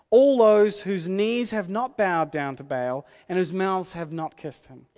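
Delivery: loud, ringing preacher's delivery; loud, emphatic Bible reading; forceful scripture reading. Acted. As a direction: proclaim it to the whole church. Reads scripture at full force.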